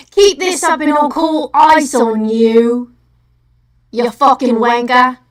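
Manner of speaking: loud, drawn-out mock British insult; exaggerated British scolding; mocking British accent. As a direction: put on a British accent and berate them loudly. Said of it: "you were mocking his accent".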